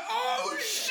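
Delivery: very high, laughing mock shriek; shocked cry. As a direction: squeal it like the stunned crowd. Voicing shocked friends.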